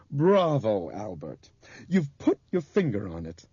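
high, loud burst of congratulation; excited praise. A narrator's reading. Bob tells Albert he's got it, excited praise. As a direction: applaud his cleverness with feeling.

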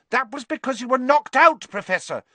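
high, earnest schoolgirl voice; earnest girl. Acted. Hermione earnestly correcting the professor, high and eager. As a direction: plead her case earnestly. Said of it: "said Hermione earnestly".

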